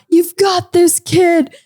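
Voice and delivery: high-pitched call from the crowd, volume held back; retold cheer. Albert cheering her on from the crowd, mock call-out. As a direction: imitate someone calling out encouragement. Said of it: "And I hear in the crowd".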